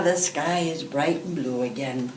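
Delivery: usual speaking voice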